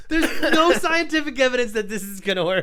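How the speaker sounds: words through high laughter; laughing while talking. Real, not acted. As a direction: talk while cracking up. Laughing through the retold disbelief.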